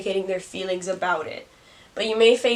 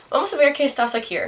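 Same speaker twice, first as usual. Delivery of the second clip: higher, fast replay in a bad Spanish accent with an R stuck on "aquí"; quicker, higher second take in a bad Spanish accent; 6 semitones higher, 5 dB louder, much faster than usual. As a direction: rattle it off quickly, adding an R to "aquí". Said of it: "add an R to aquí".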